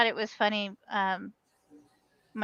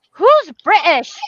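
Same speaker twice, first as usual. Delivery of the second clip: slow, loud, higher sassy retort; sassy kid quip; 6 semitones higher, 9 dB louder, slower than usual. Retold or imitated. Son mocking his mom's word choice, sassy and slow. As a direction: drawl it out like a cheeky kid. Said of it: "He said it just like that".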